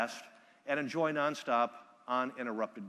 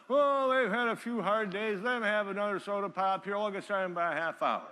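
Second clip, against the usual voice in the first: lazy host; 6 semitones higher than usual. Retold or imitated. Speaker imitating the tipsy host brushing him off.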